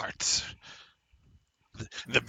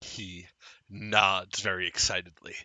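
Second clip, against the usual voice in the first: ordinary talk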